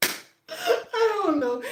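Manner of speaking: high, slow, dissolving into laughter; words melt into real laughter; laughing helplessly. Real, not acted. Host can't stop laughing at the narcolepsy joke, high and slow.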